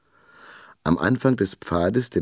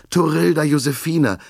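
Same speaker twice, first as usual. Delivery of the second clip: name called out much higher and louder, anxious; worried call; 10 semitones higher, 4 dB louder than usual. A narrator's reading. Father calls to his found girl, anxious and loud. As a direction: cry out Torilda Josefina with worry. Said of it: "rief er besorgt".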